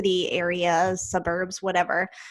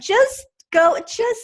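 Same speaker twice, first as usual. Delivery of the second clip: high-pitched, pleading urging; exasperated encouragement; 5 dB louder, slower than usual. Retold or imitated. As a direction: urge her on in mock exasperation. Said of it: "And I'm like".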